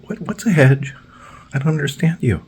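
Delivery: very high confused voice; high mock confusion; mock clueless worker. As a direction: play dumb up high. Mocking dazed lawnmower man.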